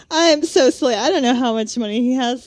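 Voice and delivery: high, airy mock ditz; ditzy heroine voice. Mocking the book's heroine as a high ditz. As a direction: ham up a silly airhead. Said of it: "Like, just like".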